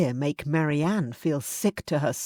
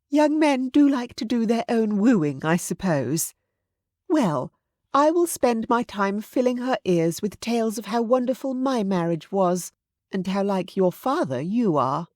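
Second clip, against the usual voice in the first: higher-pitched aristocratic mother, musing aloud; meddling mother; 6 semitones higher than usual. A narrator's reading. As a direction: play a doting mama hatching her plan. Narrator voices the meddling mother.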